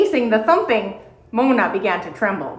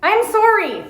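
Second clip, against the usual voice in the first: high, small apology; a girl apologising up to a bear; apologetic girl; 10 semitones higher than usual. A narrator's reading. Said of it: "she said".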